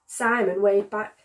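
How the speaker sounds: ordinary delivery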